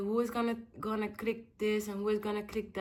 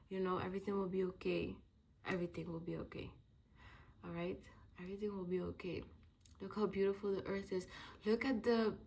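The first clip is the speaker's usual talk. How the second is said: quiet self pep talk; 2 semitones lower, 6 dB quieter than usual. Real, not acted. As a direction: gently talk yourself back up.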